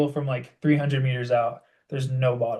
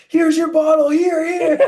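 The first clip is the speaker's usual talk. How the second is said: calling out; 9 dB louder, slower than usual. Retold or imitated. Stranger chasing him with bottle.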